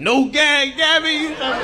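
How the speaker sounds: mock high voice. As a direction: squeal it out high and silly. Mocking reply about baby name.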